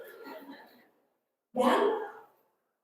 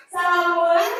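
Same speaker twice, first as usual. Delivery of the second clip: higher, louder, bright rising salam; eager husband greeting; 4 semitones higher, 7 dB louder than usual. Shown as a demonstration. Husband coming home, eager and excited. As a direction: burst in the door glad to be home. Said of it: "Atau ibu mau suaminya".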